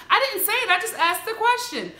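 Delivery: high, innocent "I just asked" voice; imitating a gossip who pretends she only asked; mock innocent excuse. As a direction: play dumb in a high voice. Mocking messy people's excuse.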